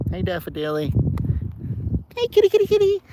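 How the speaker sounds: very high singsong call to the cat. Real, not acted. Calling the cat over in a very high voice. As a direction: coax the kitty with a sky-high sweet call.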